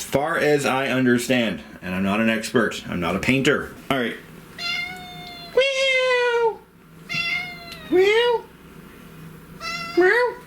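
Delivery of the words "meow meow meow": very high imitated meow; cat meow. Owner meowing back to the cat, very high.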